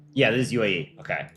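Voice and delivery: ordinary talk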